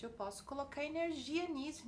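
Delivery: normal talking voice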